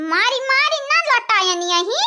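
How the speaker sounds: ordinary delivery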